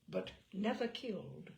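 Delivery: ordinary delivery, nothing marked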